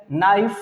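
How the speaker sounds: नाइफ begun on the N, no K sound; silent K. Shown as a demonstration. Teacher explaining silent K, नाइफ starts on N. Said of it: "क्योंकि के silent होता है".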